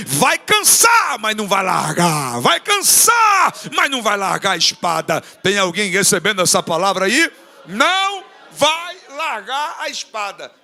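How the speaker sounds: loud, shouted preaching that builds; fiery revival preaching; full-force preaching. Acted. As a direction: preach it to the rafters.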